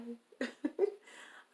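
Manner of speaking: high rising giggle; giggle fit. Real, not acted. Saying hello to viewers, she dissolves into giggles. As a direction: giggle that won't stop. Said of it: "I just wanna have a giggle fest".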